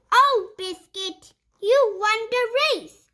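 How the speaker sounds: lively picture-book read-aloud; reading to children. Acted. Reading a puppy picture book to young viewers, bright storytime voice. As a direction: read it like storytime for little kids.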